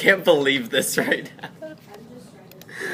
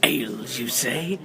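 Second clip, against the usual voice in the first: intrigued hobgoblin; 4 dB quieter, slower than usual. Acted. Hobgoblin's first reaction to offer.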